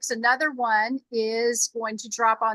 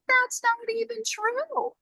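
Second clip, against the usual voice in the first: incredulous objection; 9 semitones higher than usual. Retold or imitated. Telling her husband he's wrong, higher and indignant. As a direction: play up the disbelief, pitched up.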